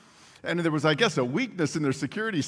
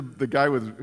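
ordinary talk

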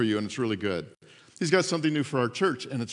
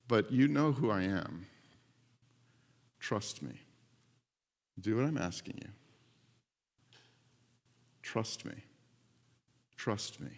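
very soft, low, hushed plea; almost whisper it, low and unhurried; gentle reassurance; 7 semitones lower, 8 dB quieter than usual. Retold or imitated. God asking his children to trust him, hushed reassurance.

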